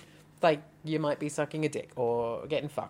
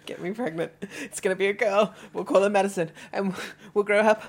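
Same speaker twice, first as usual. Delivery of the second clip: mock eager plea; 5 semitones higher, 3 dB louder than usual. Acts out absurd dirty talk.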